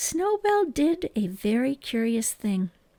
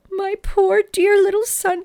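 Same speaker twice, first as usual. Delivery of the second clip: much higher, louder, slow and tearful; crying mother; 9 semitones higher, 6 dB louder than usual. A narrator's reading. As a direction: sob it out over her lost son. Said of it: "Missus Little began to cry".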